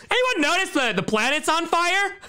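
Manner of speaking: high mock question; mocking viewer voice. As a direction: parody a doom-posting viewer.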